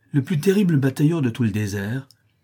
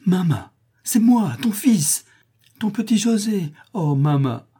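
high, loud joyful cry to mother; 10 semitones higher, 4 dB louder, slower than usual. A narrator's reading. Son throws himself at his mother's neck, crying out. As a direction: call out high, stretching the first word. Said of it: "se jette à son cou en criant".

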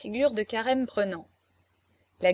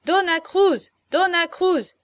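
high, louder repeated calls; crowd shouting; 7 semitones higher, 5 dB louder, slower than usual. A narrator's reading. Gentlemen calling for Dona Cruz, high raised shouts. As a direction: call out the name like clamouring men. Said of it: "criaient ces messieurs".

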